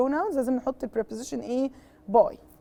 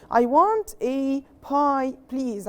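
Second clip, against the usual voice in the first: slow customer line; much slower than usual. Acted. Teacher voices Omar ordering.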